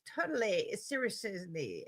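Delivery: mock Valley Girl, seriously drawn out slow; Valley Girl intensifiers. Shown as a demonstration. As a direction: gush totally, then stretch seriously. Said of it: "Intensified likes".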